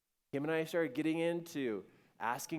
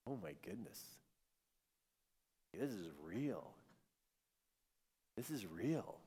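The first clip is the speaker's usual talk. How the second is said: hushed awe; 5 semitones lower, 10 dB quieter than usual. Retold or imitated. Couple sitting stunned after hours of crying, hushed awe. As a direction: let it sink in quietly, reverently.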